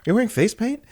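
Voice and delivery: high, quick mock question; squinting question. Coworker peering at the blue-faced customer, squinting question. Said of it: "he does the squint like".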